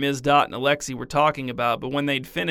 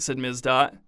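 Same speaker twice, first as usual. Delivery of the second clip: plain reading voice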